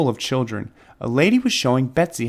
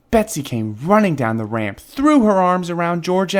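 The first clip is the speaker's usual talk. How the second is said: ordinary delivery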